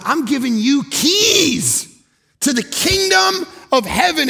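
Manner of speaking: high, slow, shouted proclamation; booming Jesus. Acted. Jesus giving the keys to believers, booming shout. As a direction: proclaim it like heaven's promise. Jesus voiced, preaching climax.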